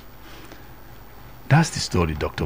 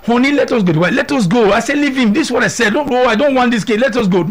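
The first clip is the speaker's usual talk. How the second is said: much higher pleading voice; begging wife; 12 semitones higher, 4 dB louder than usual. Retold or imitated. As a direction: beg him to drop it. Wife begging husband to leave.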